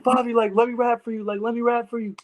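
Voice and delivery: high, eager begging call; eager pleading. Retold or imitated. Guest retells pleading to rapper.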